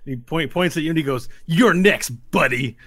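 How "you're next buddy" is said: loud taunt. Acted. Kanja taunts the player.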